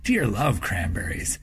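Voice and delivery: ordinary delivery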